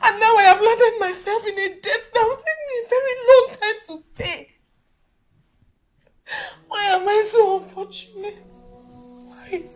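crying, high-pitched despair; tearful lament. Acted. Single mother lamenting lost job, home and debt, tearful. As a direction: wail out your misfortunes through tears. Woman laments her ruined life.

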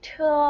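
เธอ held long on a high level pitch; demonstrating a pinyin sound. Shown as a demonstration. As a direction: say เธอ as one loud, high, sustained model sound. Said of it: "ออกเสียงว่า".

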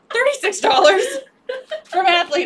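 high, loud burst of playful outrage; mock outraged shout. Seller to co-host over a misheard price, mock outraged shout. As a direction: shout it in exaggerated disbelief.

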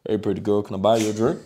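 low, soft cowboy-style pickup line; put-on cowboy accent.

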